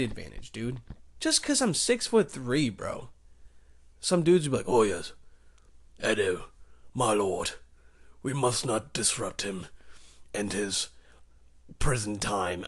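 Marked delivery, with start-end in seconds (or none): 4.7-12.6 low, soft, mock-noble courtier voice; bow and murmur like a loyal servant; reverent courtier. Inmates address their lord Edo in a low, reverent courtier voice.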